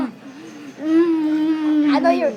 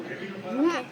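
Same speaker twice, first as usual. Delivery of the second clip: normal talking voice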